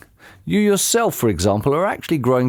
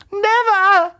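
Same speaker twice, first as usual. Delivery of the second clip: put-on much higher, dim old woman's voice; squeaky puzzled old lady; Missus Twit voice; 4 dB louder than usual. A narrator's reading. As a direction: play her as a high, befuddled old wife.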